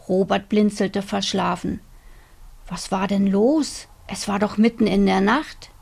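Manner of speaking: plain reading voice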